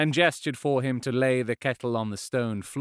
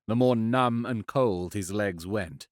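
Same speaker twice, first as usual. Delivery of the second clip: usual speaking voice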